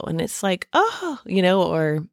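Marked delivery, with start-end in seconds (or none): 0.7-1.2 a sudden high gasp of surprise; reliving the thrill of an unexpected offer; surprised oh. Retold or imitated. A recalled sudden job offer, high delighted surprised oh.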